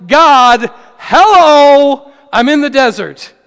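loud, drawn-out cry to God; anguished prayer at high volume; painful pouring out. Acted. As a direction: call out loudly from the desert, pouring it out. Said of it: "painful, pouring out".

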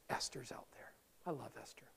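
a secret tip-off, unvoiced; whispered aside to the congregation. Real, not acted. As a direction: whisper it to the room. Aside about Esther.

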